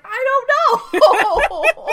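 high, loud, startled "I don't know!"; panicked denial. Retold or imitated. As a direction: blurt it out in a panic. Ando denies knowing, acted.

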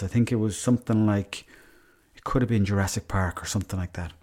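usual speaking voice

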